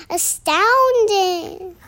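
high, slow, drawn-out amazement; astounded exclamation. Acted. Gruffalo impressed by the mouse, astounded exclamation. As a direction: stretch it out high in wonder.

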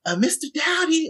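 lightly voiced young student raising her hand; a hint of a timid third grader; schoolgirl voice. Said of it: "They said".

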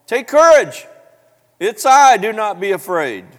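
loud, high, commanding call. Acted. Jesus calling to frightened disciples in the storm, calling out. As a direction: shout it across the wind to them.

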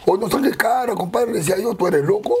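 mock protest at his partner; mock angry argument. Clown retells arguing with his partner Tripa, indignant.

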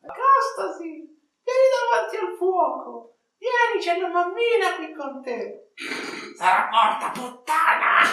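very high, far-off shrieking call; calling from far away. Acted. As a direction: shriek up from the cellar. Villain calls up from cellar.

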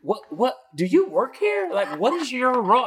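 can't believe what she's hearing; baffled questioning. Retold or imitated.

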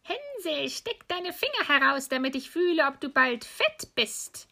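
very high, singsong witch call. Acted. Witch checking on caged Hänsel, high coaxing call. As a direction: call high, then draw out the last words. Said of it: "schlich die Alte zu dem Ställchen und rief".

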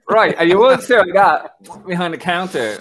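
loud, high, laughing through the line; laughing disbelief. Retold or imitated. Telling the co-hosts about the bar, loud and laughing. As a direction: blurt it out between laughs.

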